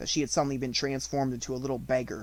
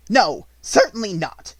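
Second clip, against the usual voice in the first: slow, louder cold refusal; furious refusal; 7 semitones higher, 10 dB louder than usual. A narrator's reading. Headmistress refusing the maid, slow and loud. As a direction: stamp down on it. Minchin stamps her foot.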